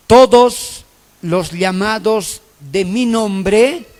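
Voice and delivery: slow, emphatic reading of a Bible verse; scripture reading. Acted. Preacher reading Isaiah to the congregation, slow and emphatic. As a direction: read the verse slowly, leaning on each phrase. Reads scripture slowly, aloud.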